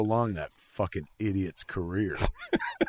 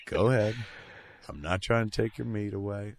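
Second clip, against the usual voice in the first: ordinary talk, nothing marked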